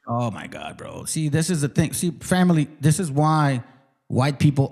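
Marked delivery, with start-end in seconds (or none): 0.1-1.0 very low, softer exasperation; low muttered disbelief; exasperated. Real, not acted. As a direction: drop the voice right down, fed up. Exasperated at being called brain dead.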